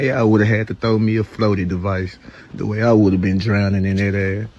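ordinary talk

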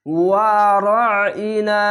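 ওয়ারাইনা slowly, each long vowel held; drawn-out recitation. Shown as a demonstration. Teacher modelling the one-alif stretch, slow and drawn out. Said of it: "এক আলিফ টেনে পড়বেন".

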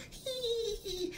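high, soft mocking giggle. Vlogger mocking Dom as a giggling pushover, high titter. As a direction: titter like a silly kid. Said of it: "What if Dom was just still".

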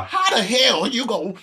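high, loud, exasperated outburst; hammed-up indignant holler in a put-on voice; Steve Harvey. Trying a Steve Harvey take on the insult, loudly indignant. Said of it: "I feel like Steve Harvey right now".

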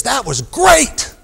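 high, slow, loud delighted shout, stretching each word; thrilled shout. Retold or imitated. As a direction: shout it out like she just had the ride of her life. Thrilled driver after the high-speed chase.

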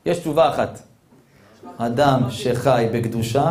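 ordinary delivery

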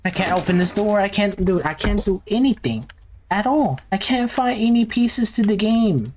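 high-pitched frustrated complaint; exasperated whining. Real, not acted. Stuck player complaining about the game, exasperated. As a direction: whine in helpless frustration, pitch held up.

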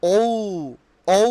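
"ঔ" as a long gliding ou; demonstrated vowel. Shown as a demonstration. Teacher showing the o sound, "ঔ" drawn out. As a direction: isolate "ঔ", gliding from o to u. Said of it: "ও এর উচ্চারণ হবে".

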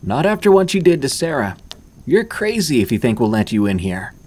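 put-on a girl yelling at the boy outside, loud then easing; yelling back. A narrator's reading. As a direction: shout him down, then settle. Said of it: "yelled back".

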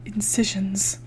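hushed, whispered single word; whispered echo. Real, not acted. Repeats misheard word, breathy hush.

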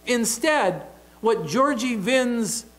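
usual speaking voice

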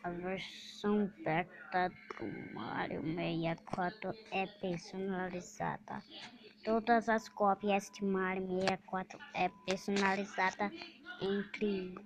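low, hushed eerie narration; creepy narration. Acted. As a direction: tell it like a ghost story.

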